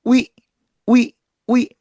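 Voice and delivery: 위 said as oo then ee; split wi. Shown as a demonstration. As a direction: glide 위 from oo to ee. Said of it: "우하고 이를 따로따로".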